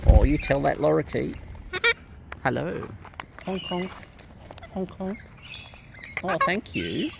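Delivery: ordinary talk, nothing marked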